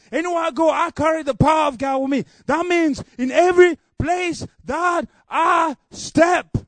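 ringing pulpit proclamation, slowing on the last lines; full-force preaching. Acted. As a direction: preach it, rising to a roar on every place I step.

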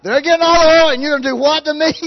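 outraged punchline. Acted. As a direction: repeat the punchline as an indignant yell. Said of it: "That's a pretty good joke".

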